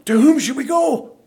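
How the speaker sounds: high-pitched emphatic retort; emphatic, incredulous comeback. Retold or imitated. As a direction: answer instantly as if the question were unthinkable. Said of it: "Why are you so emphatic?".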